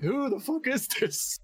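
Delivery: high, slow incredulous mock voice; baffled. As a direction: play dumbfounded disbelief. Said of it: "And I'm like".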